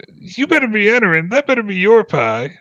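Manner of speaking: high, loud protest; indignant villager. Acted. Villager protesting at the creature tasting his pie, indignant. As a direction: scold the guest who tasted his pie.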